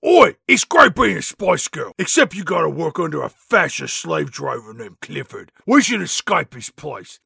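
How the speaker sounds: put-on cockney [name] voice; a hammed-up London "Oi" girl. [name] to the contest winners, in put-on cockney.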